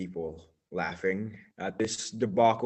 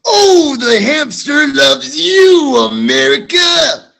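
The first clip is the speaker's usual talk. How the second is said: excited cheer; 19 dB louder than usual. Acted. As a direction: shout it high to the crowd, dragging it out. Hamster character cheers America.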